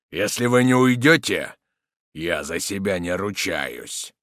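slowing, dropping warning; restrained threat. A narrator's reading. Soldier warning the villain to leave, restrained threat.

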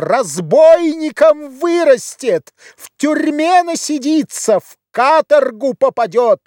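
very high, drawn-out strident cries; shrieking. A narrator's reading. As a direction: wail out dire predictions at the top of the range. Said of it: "и она кричала". (истошный женский крик, очень высоко)

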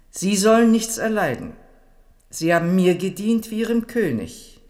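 emphatic outburst. Acted. The queen overrules Mornay's protest with a loud, high declaration. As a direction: cut him off and proclaim your favour loudly.

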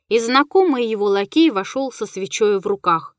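usual speaking voice

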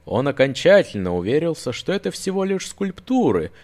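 ordinary delivery

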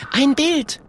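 high-pitched little character voice; explaining Mathilda. A narrator's reading. Mathilda explains the word to the bear, helpful. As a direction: pipe up high and clear. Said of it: "erklärt Mathilda".

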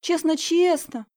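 put-on high childish pleading; whining girls. Girls begging teacher to keep them, high whine. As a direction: promise like a guilty little girl. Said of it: "заныли подружки".

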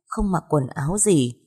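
plain reading voice

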